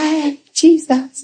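high, slow, dreamy sweetness; teasing imitation of a sugary saint; sweet old church lady. Mimicking sweet Sister Sally.

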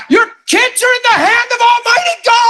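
very high, overwrought re-enacted scream; retold hysterical proclamation, pitch sky-high; screaming and bawling. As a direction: cry it out at the top of your range. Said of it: "I'm screaming, I'm bawling".

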